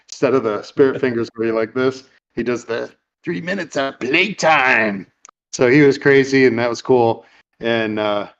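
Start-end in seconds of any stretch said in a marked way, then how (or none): 3.2-5.1 slow, higher, swooping imitation; taunting cage fighter. Crazy fighter with spirit fingers toys with Peter, taunting. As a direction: draw out the last word with relish.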